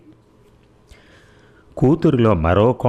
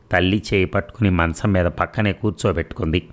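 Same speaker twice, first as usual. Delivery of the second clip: plain reading voice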